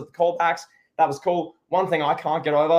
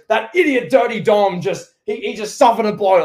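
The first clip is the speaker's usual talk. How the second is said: mock commentator yell, higher and louder; imitating Cole yelling; 6 semitones higher, 5 dB louder than usual. A podcaster imitates Michael Cole yelling at ringside, mock yell. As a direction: shout it like an excited play-by-play man. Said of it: "Michael Cole yelling".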